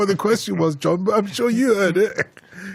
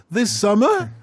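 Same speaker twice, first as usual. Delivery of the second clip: exaggerated high rise on "summer"; mock the caller, swooping "summer" up into a question; mimicked uptalk; 6 semitones higher than usual. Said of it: "going up at the end of your sentence".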